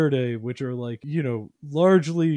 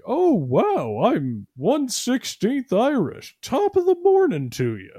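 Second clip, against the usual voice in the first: much higher mock voice of a gushing Westerner, then a hammed-up Irish greeting; 11 semitones higher than usual. Balkan posters mocking Westerners with DNA tests, high and hammed-up. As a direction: ham it up like a proud fake-Irish tourist. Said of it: "they'll be like".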